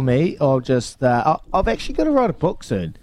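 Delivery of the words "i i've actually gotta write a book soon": normal talking voice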